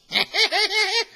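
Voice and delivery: high, loud burst of laughter; loud shrieking laugh. Real, not acted. Laughing loud while retelling the reveal. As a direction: cackle out loud at the joke.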